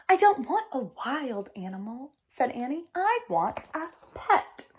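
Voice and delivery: lively storybook read-aloud with voiced characters; reading to children. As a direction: read it like story time for kids. Teacher reads picture book aloud.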